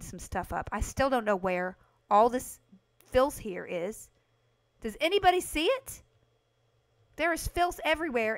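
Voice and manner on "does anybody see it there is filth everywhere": high, loud complaint; raised-voice griping; exasperated. Real, not acted. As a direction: appeal to everyone in frustration. Cannot find the filth in game.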